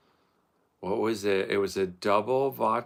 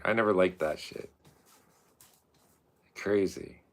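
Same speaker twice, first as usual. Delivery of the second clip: ordinary talk, nothing marked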